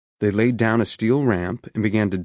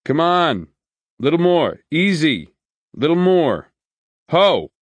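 loud guiding shouts, high and loud; hollering; 7 semitones higher, 5 dB louder than usual. A narrator's reading. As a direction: call out to the backing truck. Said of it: "a guy was hollering".

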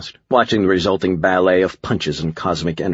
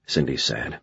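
ordinary delivery

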